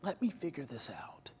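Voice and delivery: soft, sinking into a whisper; calm self-talk. Shown as a demonstration. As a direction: talk yourself down gently. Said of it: "you say".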